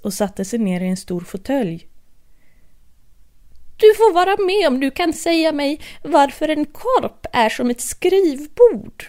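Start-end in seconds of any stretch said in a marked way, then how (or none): 3.8-9.0 hatter's riddle. Acted.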